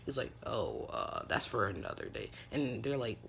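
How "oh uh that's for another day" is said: much lower, mysterious brush-off; deep evasive voice. Acted. Izuku dodging the boys' question, deep mysterious voice. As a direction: drop the voice low and cryptic.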